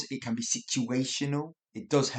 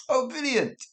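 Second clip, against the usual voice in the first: high, sincere delighted exclamation; quick, high-pitched approval; genuinely pleased; 13 semitones higher than usual. Shown as a demonstration. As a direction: say it as if it's truly great news. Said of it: "That's great" for the first one".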